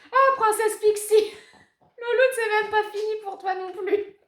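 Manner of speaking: high, loud doggy voice; excited pet talk. Real, not acted. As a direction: gush at the dog. Excited talking to dog.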